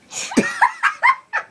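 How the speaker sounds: very high, loud giggle; laughing at her own joke; squealing laugh. Real, not acted. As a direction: break into a shrill laugh.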